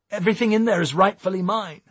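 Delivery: high, loud, anxious insistence; nervous. A narrator's reading. Eric defending his truck from a search, nervously. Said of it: "said Eric nervously".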